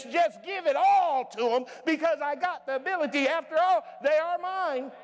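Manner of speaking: loud, high preaching as Jesus; full-force preaching. Acted. Preacher voicing Jesus to the church, loud. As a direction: preach it hard to the back row.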